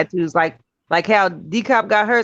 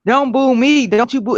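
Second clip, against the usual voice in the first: mock man's voice; 5 semitones higher than usual. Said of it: "he made his voice like a man's voice".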